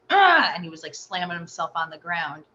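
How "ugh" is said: high, loud "ugh"; imitated tantrum grunt. Mother imitating her son's tantrum next door, high loud grunt. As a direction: mimic a furious toddler's grunt. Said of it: "I could hear him, like".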